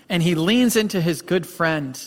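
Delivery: normal talking voice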